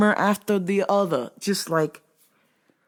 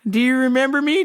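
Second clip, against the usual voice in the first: mock customer, much higher; 8 semitones higher, faster than usual. Worker mimicking returning customer, higher. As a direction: ask coyly like the odd customer, pitched up.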